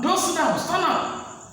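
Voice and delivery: high-pitched sharp order; commanding. Acted. Lecturer to a late student walking into class, commanding. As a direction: command it with the pitch thrown right up. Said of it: "you are being commanded".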